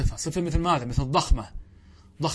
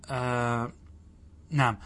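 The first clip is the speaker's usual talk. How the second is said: ordinary talk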